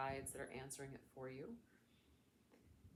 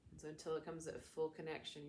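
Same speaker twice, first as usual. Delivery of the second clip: ordinary delivery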